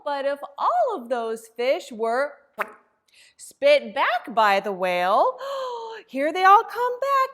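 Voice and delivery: put-on surprise the kids with the fish coming back; excited gasp. Librarian gasping with joy as all the fish return.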